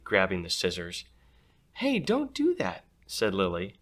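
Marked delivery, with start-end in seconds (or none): 1.7-2.8 put-on protesting girl. Lily protests.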